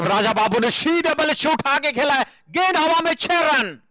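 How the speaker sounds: high, excited call of a six; excited cricket commentary. Acted. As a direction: lift the voice for the six.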